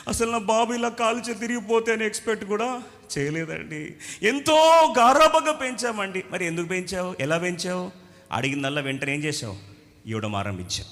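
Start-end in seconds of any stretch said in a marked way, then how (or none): 0.1-6.2 a grieving father, hammed-up; mock wailing parent. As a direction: lament building louder and higher. Crying parent after accident.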